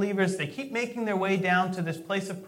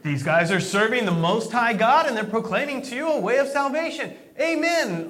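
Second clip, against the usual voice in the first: retold proclamation; 4 semitones higher, 5 dB louder than usual. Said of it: "what is she saying".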